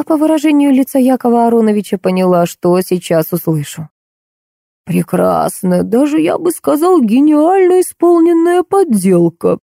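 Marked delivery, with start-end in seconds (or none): 4.8-9.6 put-on very old man. A narrator's reading. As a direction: croak it out like a frail old man. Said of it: "закряхтел старик".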